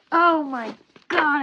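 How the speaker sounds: high, loud and slow swoon; gushing oh my god. Real, not acted. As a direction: swoon over the characters. Gushing over favorite characters.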